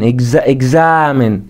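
اكزامن with a drawn-out a, slowly; stretched vowel. Shown as a demonstration. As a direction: draw out the a in اكزامن. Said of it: "بتمدي فيها شوية".

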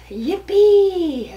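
high, loud cheer of joy; yippee. Acted. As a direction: burst out with delight. Willie thrilled to tap dance.

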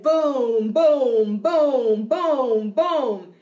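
booming drumbeats, each one falling; low drum voice. Shown as a demonstration. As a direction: boom steadily like a drum. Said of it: "Low voice sounds like".

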